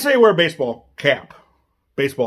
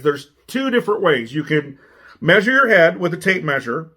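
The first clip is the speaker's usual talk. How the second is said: usual speaking voice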